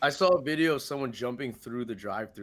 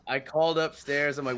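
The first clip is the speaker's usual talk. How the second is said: normal talking voice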